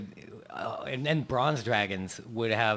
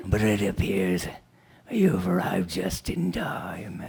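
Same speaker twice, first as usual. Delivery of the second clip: whispered dragon voice; 3 semitones lower, slower than usual. Acted. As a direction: hiss it like a snake. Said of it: "and be like".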